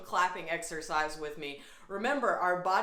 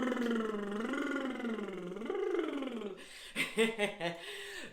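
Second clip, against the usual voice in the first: laughing on purpose; 3 semitones higher than usual. Shown as a demonstration. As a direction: let a gentle laugh bubble out. Laughter yoga session.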